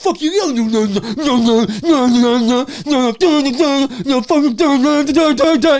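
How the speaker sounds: put-on a kid's stubborn no, rising each time; building mock refusal. Voicing teen refusing loudly.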